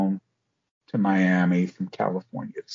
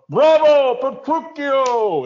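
loud, high, drawn-out cheer from the audience; big booming bravo; 7 dB louder, much slower than usual. Retold or imitated. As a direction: shout it across the theatre like a proud father. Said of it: "I hear this big voice go".

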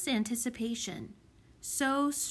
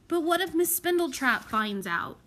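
worried protest; 7 semitones higher, 4 dB louder than usual. A narrator's reading. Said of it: "protested Plute".

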